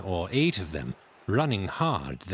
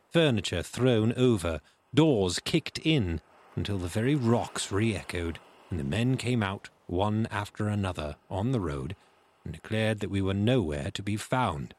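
plain reading voice

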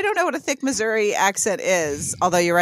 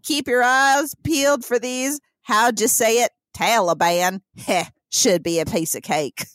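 put-on thick Missouri accent; 3 semitones higher than usual. Militia leader briefing his men, thick Missouri accent. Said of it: "in a thick Missouri accent".

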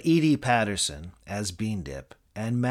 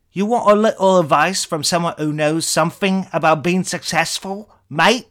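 put-on British accent ranting, higher and much louder; a furious British tirade at Americans; ranting Eric Idle; 7 semitones higher, 10 dB louder than usual. British accent, the celebrity ranting at the Yank waiter, furious. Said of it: "your British accent".

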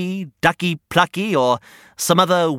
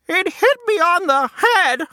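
much higher hen voice; clucking hen; 14 semitones higher than usual. Acted. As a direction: cluck it like a frazzled chicken. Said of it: "clucked Henny Penny".

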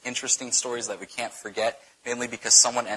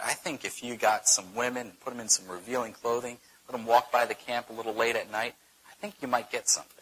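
soft, confidential scheming; hushed underhand advice, lightly acted; acted confiding; 2 semitones lower, 7 dB quieter than usual. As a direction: slip them a sly suggestion, voice dropped. Voices Balaam's underhand advice to Balak.

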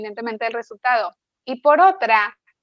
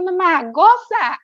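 put-on child's cheerful urging; 7 semitones higher, 3 dB louder, much slower than usual. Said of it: "la carita como diciendo".

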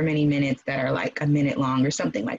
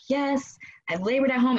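mildly excited, pitched up; 7 semitones higher than usual. Retold or imitated. Mother pleased she has labored at home, mildly excited. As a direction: retell a small triumph, lifted in pitch. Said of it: "so I'm excited".

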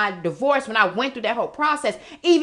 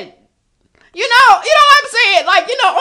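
high, loud, drawn-out "you know"; loud emphatic; 12 semitones higher, 12 dB louder, slower than usual. Real, not acted. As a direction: belt it out to the camera. Emphatic aside to viewers.

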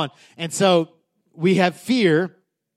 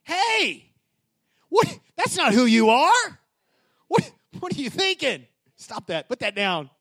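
Holy Spirit correcting; 5 semitones higher than usual. Retold or imitated. Holy Spirit lovingly correcting a child who slipped up, high and kind. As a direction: gently snap someone out of a bad habit. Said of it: "the Holy Spirit does this".

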